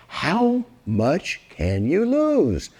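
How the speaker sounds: ordinary delivery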